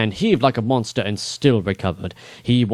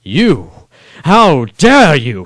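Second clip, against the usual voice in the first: slow, much louder furious roar; enraged lord roaring at his liege; 5 semitones higher, 10 dB louder, much slower than usual. Acted. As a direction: roar at the king in rage. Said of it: "he roared".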